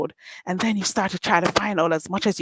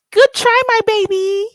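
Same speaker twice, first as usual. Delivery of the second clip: high, loud sing-song taunt; high loud patronising good try; mocking taunt; 13 semitones higher, 6 dB louder than usual. Real, not acted. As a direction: coo it back condescendingly. Taunt raised.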